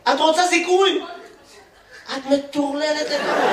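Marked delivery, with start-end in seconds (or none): 2.1-3.5 slower, each word stretched; slow drawn-out repeat. Acted. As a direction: drag it out in disbelief. Same words said again slower. (חזרה איטית ומתמשכת)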